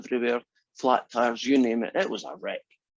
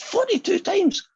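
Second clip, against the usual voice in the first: high, drawn-out mock disbelief; incredulous echo; 4 dB louder, slower than usual. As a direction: repeat it high and slow, acting astonished. Saint Peter reacts to the confession.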